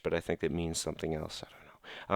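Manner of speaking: hushed aside, barely voiced; sly whispered aside. Real, not acted. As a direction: whisper it like a secret. Aside about French insult.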